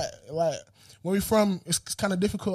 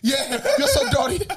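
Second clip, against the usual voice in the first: slow, drawn-out laughing echo; laughing imitation; 4 semitones higher, slower than usual. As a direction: stretch it out while laughing. Echoing the catchphrase.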